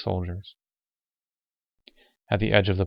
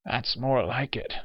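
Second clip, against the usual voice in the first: higher, weak gasp of approval; weakly satisfied gasp; 4 semitones higher, 4 dB quieter than usual. Acted. Old man watches the cop's blow, gasping weakly satisfied. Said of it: "gasped in weak satisfaction".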